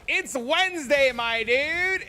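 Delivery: high, loud "It's Wednesday, my dude"; meme voice. Retold or imitated. Dad teasing his son at breakfast with a meme. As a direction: say it like the frog meme. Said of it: "I was like".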